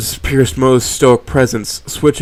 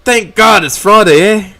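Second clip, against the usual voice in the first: jolly greeting; 9 semitones higher, 10 dB louder than usual. Acted.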